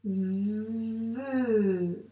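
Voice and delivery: "Ve" with a very long v gliding into held e; sounded-out syllable. Shown as a demonstration. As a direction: buzz through the v of "Ve" as if tracing it. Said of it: "donc on doit le dire, les sons".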